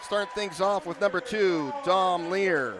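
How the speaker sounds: big booming lineup introductions; echoing stadium announcer. Acted. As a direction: announce each name to the arena. Reads home lineup over PA.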